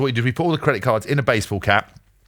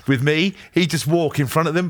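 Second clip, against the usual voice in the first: ordinary delivery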